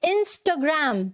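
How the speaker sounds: Instagram slowly as "ins-tuh-gram"; short rounded middle in Instagram, "in-sto-gram", English "gram"; corrected pronunciation. Shown as a demonstration. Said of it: "क्या बोलना है?".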